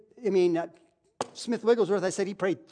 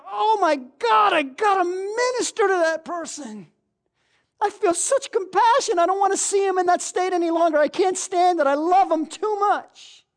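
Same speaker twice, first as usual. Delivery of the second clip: high, crying plea of a burdened heart; anguished inner plea; 9 semitones higher, 4 dB louder than usual. Acted. Preacher voicing a burdened believer, anguished and near tears. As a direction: cry it out as if your gut is in knots.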